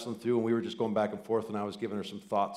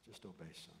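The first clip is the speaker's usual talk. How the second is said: hushed fatherly; 3 semitones lower, 23 dB quieter than usual. Retold or imitated. God's command, softer to son.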